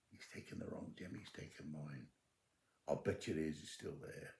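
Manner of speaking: very soft, stunned realization; sinking, low-volume admission; hushed dismay. Retold or imitated. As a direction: say it almost under the breath. Terry realizes the terrible mistake.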